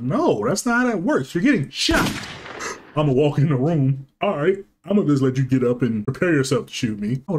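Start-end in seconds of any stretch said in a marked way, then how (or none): none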